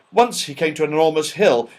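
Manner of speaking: ordinary delivery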